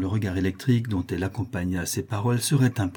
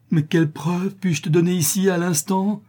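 high, desperate question; anguished cry; 9 semitones higher, 4 dB louder than usual. A narrator's reading. Notary wringing his hands over the proof demanded, anguished cry. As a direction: plead in torment, pitch way up. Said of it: "Cria le misérable en se tordant les mains".

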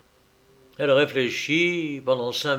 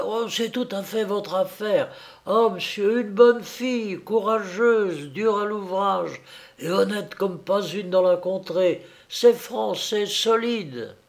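higher, eager farmwife's sales pitch; warming up; 7 semitones higher than usual. A narrator's reading. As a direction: sing her praises with growing fervor. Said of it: "elle ajouta en s'échauffant". (fermière qui s'échauffe, voix plus aiguë)

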